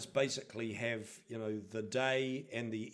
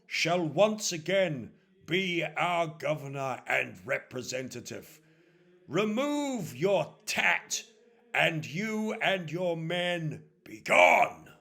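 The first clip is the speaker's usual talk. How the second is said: slow, loud proclamation rising to a shout; stern priest banishing; 5 semitones higher, 8 dB louder, slower than usual. Acted. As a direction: thunder out the dismissal.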